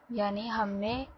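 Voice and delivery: normal talking voice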